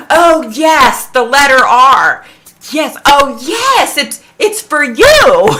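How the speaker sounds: loud, high, mock-ecstatic psychic; exclaimed revelation. Imitating a psychic telling a viewer it's theirs, ecstatic. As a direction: build to a drawn-out, triumphant proclamation.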